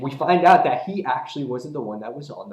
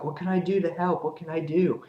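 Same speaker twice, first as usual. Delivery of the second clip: high, quick imitation of a friend; worried friend; 7 semitones higher than usual. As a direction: mimic an eager friend in a raised voice. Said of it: "we see her friend".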